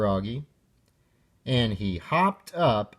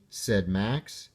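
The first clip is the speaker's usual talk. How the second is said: ordinary delivery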